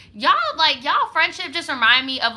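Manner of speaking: exasperated. Real, not acted. Exasperated outburst at listeners.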